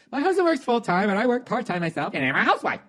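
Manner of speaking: high, loud mocking reading; mock letter writer. Host mocking the wife's garbled letter, high voice. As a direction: parrot her clumsy sentence.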